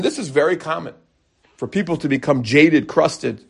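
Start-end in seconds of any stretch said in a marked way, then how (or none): none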